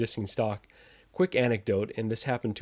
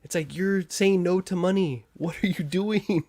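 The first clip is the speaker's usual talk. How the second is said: exasperated disbelief; 8 semitones higher than usual. Real, not acted. Exasperated at company refusing money.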